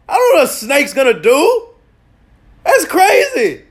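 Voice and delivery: loud, high, laughing disbelief; high-pitched rant. Real, not acted. Ranting about the snake.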